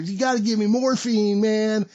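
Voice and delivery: high, pleading yell; hammed-up desperate demand, pitched high; mock screaming. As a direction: beg like the wailing patient. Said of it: "this asshole screaming his fucking head off".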